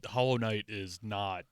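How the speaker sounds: ordinary delivery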